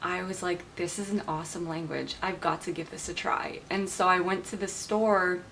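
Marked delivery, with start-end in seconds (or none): none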